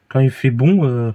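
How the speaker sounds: usual speaking voice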